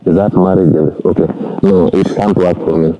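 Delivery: normal talking voice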